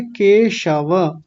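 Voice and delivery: "केशव" slow, ke-sha-va with sh; correct palatal sh. Shown as a demonstration. Teacher correcting students, slow "केशव" with sh. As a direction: draw out "केशव", full final a. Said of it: "तो क्या बोलेंगे?".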